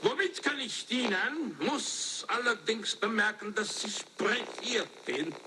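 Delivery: slow, lilting Viennese German; high-pitched Viennese greeting, easing down slowly; Viennese accent. Shown as a demonstration. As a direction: take your time in an opera-house Viennese accent. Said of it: "this is the way I do it with my Viennese accent".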